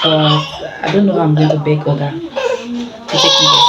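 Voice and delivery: hammed-up crying beg; wailing like a spoiled child for corn; mock whine. Mock begging husband for corn.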